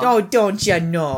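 put-on slow, drawn-out Wisconsin accent, don't you know. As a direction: rek de klinkers in een Wisconsin accent. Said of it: "I love that accent".

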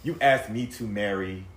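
usual speaking voice

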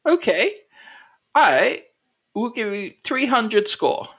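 high-pitched colonel, weighing an offer; haggling colonel. Acted. Game master voices Colonel haggling.

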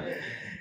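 short airless chuckle; soft unvoiced laugh; breathy laugh. Real, not acted. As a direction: let out a quiet laugh.

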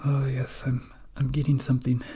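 quiet mystic sensing a vision; fortune teller. Acted. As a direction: murmur like a psychic reaching out. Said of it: "an oracle or a fortune teller".

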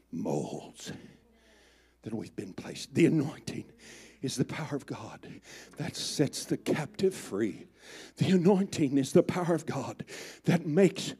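almost whispering the truth; hushed intense. Acted. Soft intense repeated declarations.

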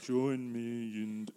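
deep sexy voice. Retold or imitated. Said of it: "he has a deep, sexy voice".

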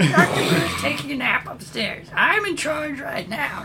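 put-on very high, thin old lady voice; sweet old lady. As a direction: speak like a frail little granny. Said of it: "she explains".

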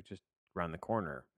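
usual speaking voice

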